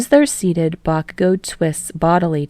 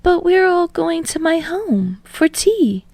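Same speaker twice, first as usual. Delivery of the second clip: high, slow polite voice; gently protesting hostess; Yaoyorozu voice; 11 semitones higher than usual. A narrator's reading.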